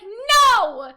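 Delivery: loud, high, dismayed outburst; disappointed Weiss. Retold or imitated. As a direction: act out an appalled protest at full volume. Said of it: "look at how disappointed Weiss is".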